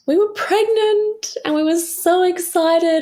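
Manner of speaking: high, slow, thrilled and drawn out; overjoyed, voice soaring high; excited. Real, not acted. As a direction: let the joy lift the voice right up. Excited pregnancy news.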